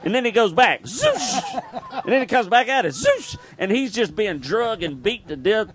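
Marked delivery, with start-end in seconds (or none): 0.8-1.6 whoosh sound. Retold or imitated. Boy swinging on limb. 3.1-3.4 very high swinging whoosh; whoosh sound. Retold or imitated. Telling the crowd how Jay swung back, a high whoosh.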